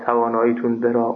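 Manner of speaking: normal talking voice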